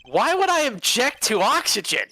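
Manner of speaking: high-pitched, incredulous question; baffled protest. Acted. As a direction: question it in exasperated disbelief. Game lawyer baffled by sidekick.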